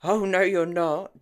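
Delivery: loud flat refusal; thundering. A narrator's reading. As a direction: put your foot down with a roar. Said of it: "James thundered".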